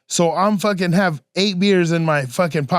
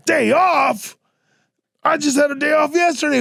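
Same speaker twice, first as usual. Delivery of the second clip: higher, indignant yelled protest; outraged drunk; 8 semitones higher than usual. Retold or imitated. Drunk worker tells the boss he doesn't need a day off, indignant shout. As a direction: drunk worker protesting being sent home, mock yell. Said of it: "This fool was tripping".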